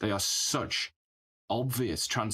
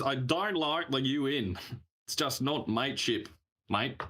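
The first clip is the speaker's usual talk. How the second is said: mock Australian accent, higher at the start; 4 semitones higher than usual. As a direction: put on a matey Australian accent. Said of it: "That's an Australian accent".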